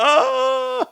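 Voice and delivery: high, loud mock gasp of oh; a startled squeal, half laughing; high laughing yelp of shock. Overwhelmed reaction.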